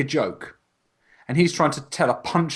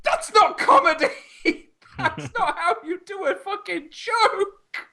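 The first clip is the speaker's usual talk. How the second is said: high-pitched words through laughter; laughing hard; slower than usual. Real, not acted. Friend cracking up at the terrible punchline, high and laughing. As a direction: laugh through every word.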